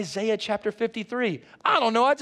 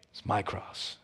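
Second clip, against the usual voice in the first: hushed whisper; 10 semitones lower, 10 dB quieter than usual. Real, not acted. Quiet personal confession of cross.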